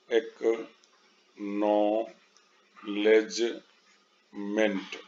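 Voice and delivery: Acknowledgement in four isolated beats, very slow, long pauses between; split into syllables. Shown as a demonstration. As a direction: break acknowledgement into एक्, नॉ, लेज, मेंट. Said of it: "इसमें तीन से अधिक syllables है".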